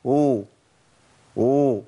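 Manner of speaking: "أو" with no proper ع in it; pronunciación errónea imitada. Retold or imitated. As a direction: imitate people saying "أو" as a drawn-out vowel. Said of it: "بسمع ناس كتير بتقول".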